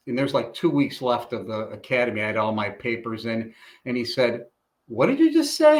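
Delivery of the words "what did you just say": much higher, stunned disbelief; a boss who can't believe his ears; shocked instructor snapping back a question; incredulous demand. Retold or imitated. Instructor shocked by student's remark.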